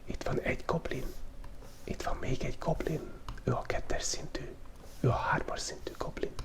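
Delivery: ordinary talk, nothing marked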